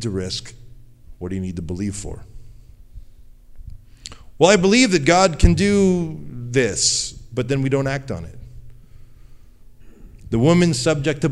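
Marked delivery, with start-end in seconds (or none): 4.4-7.1 hammed-up pious claim, higher, louder and slow; mock lukewarm believer. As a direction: lampoon someone who won't act on faith. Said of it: "mocking lukewarm believer".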